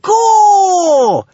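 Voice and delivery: long, high, loud launching cry; drawn-out rallying shout; yelled. A narrator's reading. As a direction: yell it out, stretching every word. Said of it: "yelled Kamikaze".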